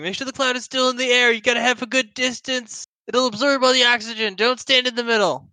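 high-pitched rapid list of warnings; instructing in character. Acted. Character explains flour-cloud arson to friend, high emphatic instructing. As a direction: drill the safety steps into a partner, pitched high.

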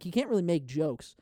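ordinary delivery

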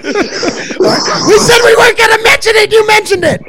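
high, loud, slow protest through laughter; squealed mock-outrage shout; outraged squeal. Real, not acted. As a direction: shriek the accusation, cracking up. Mock outrage over banned topic, laughing.

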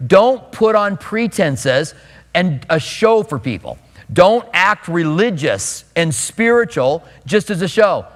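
normal talking voice

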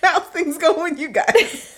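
high, loud and laughing; drawn-out question bursting with laughter. Real, not acted. Host asking listeners how they are, cracking up.

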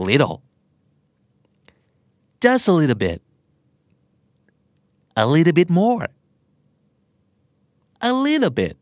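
"little" said like "liddle", tapped D; American flap T. Shown as a demonstration. Teacher drilling little in short phrases, flap T. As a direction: soften the T in "little" to a quick D. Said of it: "ออกเสียงเหมือน L I D D L E".

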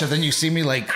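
ordinary talk

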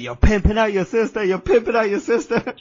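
high, loud raging father; angry dad yelling. Retold or imitated. Retelling his dad yelling at the kids, loud and high. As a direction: bellow it like a furious dad. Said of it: "He's like, losing his shit".

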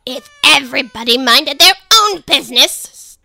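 loud hoarse duchess growl. Acted. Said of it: "in a hoarse growl".

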